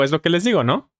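normal talking voice

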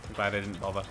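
ordinary talk, nothing marked

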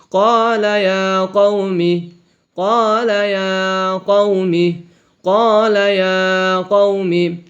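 কলা ইয়া কওমি drawn out slowly, কও short; slow recited phrase. Shown as a demonstration. Teacher recites কলা ইয়া কওমি slowly for pupils. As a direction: intone it slowly, syllable by syllable. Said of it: "তাড়াতাড়ি পড়িতে হয় কও".

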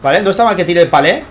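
lively esports caster calling the action; energetic game commentary; live play-by-play. Acted. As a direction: call the match as it unfolds for the viewers. Live casting of tournament match.

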